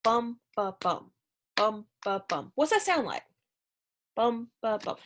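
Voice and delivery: Bum, ba, bum chanted as strong, weak, strong; heartbeat rhythm. Shown as a demonstration. Teacher beating out iambic rhythm for students, Bum, ba, bum like a heartbeat. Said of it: "It's like a heartbeat".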